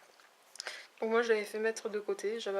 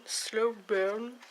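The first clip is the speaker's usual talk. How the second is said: English accent; 5 dB louder than usual. Shown as a demonstration. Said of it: "Avec, euh, l'accent, euh, anglais".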